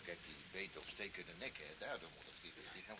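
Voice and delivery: low, confiding answer; hushed. Acted. Doctor quietly explaining the poison to the chief, hushed. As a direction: murmur it so others can't hear.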